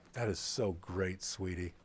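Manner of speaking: put-on soft, low and tender to a child; fond uncle. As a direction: coo it gently to the niece. Uncle fondly answers niece.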